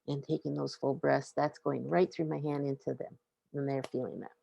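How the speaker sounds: ordinary talk, nothing marked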